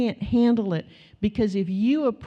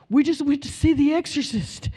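imitating a terrified young man; scared boyfriend; 6 semitones higher than usual. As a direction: sound spooked and urgent.

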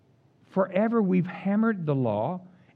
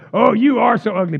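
God, blunt; 3 semitones higher, 9 dB louder than usual. Retold or imitated. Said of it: "But God goes".

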